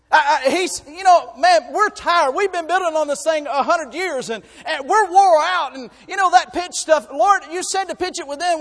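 a high, loud, half-crying complaint; mock weary Noah. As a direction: whine like a worn-out builder. Preacher voices a weary Noah.

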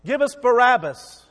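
preacher voicing the mob crying for Barabbas, loud; crowd demanding. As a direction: bellow it like a baying crowd.

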